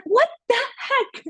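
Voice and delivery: very high, drawn-out mock outrage; slow, incredulous squeal, half laughing; retold disbelief. Acting out shock at revoked audition.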